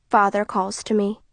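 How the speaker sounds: plain reading voice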